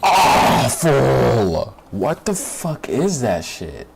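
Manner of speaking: loud, drawn-out cry of disgust; appalled exclamation at the trailer; disgusted outburst. Real, not acted. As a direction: blurt it out in real revulsion. Outburst reacting to trailer suit.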